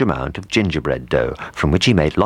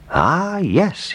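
pleased realization; 12 semitones higher than usual. Acted. Baker pleased idea.

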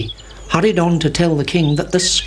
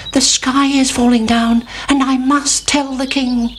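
high little chick voice; Chicken Licken; 8 semitones higher than usual. A narrator's reading. Chick runs to tell the king, high and urgent. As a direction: pipe it up like an alarmed chick. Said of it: "said Chicken Licken".

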